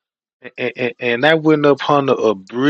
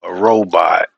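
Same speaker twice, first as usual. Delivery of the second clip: ordinary delivery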